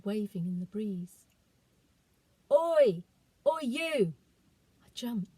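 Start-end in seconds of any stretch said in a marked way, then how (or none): 2.5-4.1 put-on a boy's loud, high call across the trees; sharp, raised "Oi!"; shouting boy. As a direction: yell to stop a thief. Boy catches goat thief.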